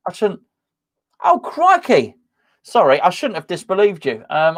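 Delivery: ordinary talk, nothing marked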